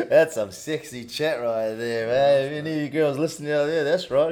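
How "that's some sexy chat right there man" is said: slow laughing tease. Real, not acted. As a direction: laugh through the words. Laughing at battery talk.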